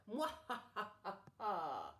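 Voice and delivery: slow hammed-up villain laugh; evil cackle. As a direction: cackle like a cartoon baddie.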